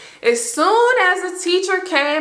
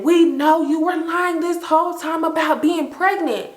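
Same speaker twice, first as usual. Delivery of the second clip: higher-pitched retold snap; snapped accusation; 7 semitones higher than usual. Friend snaps at a girl over her lie, snapped accusation. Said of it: "but she snapped and she said".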